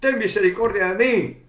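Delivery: high, loud cry of a blind beggar calling to Jesus; desperate shout. Acted. Blind Bartimeo shouting to Jesus over the crowd, desperate cry. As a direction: shout it out, begging for mercy. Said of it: "Bartimeo empezó a gritar".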